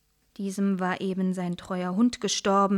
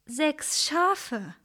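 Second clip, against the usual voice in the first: surprised; 6 semitones higher than usual. Acted. Shepherd astonished at demand.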